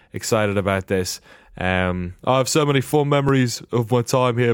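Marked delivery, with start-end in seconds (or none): 2.2-4.5 lightly attempted driver's accent; a rough stab at the returning driver's voice; attempted Daniel Ricciardo impression. Host quoting the returning driver's statement, half-hearted impression. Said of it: "I can't do him either".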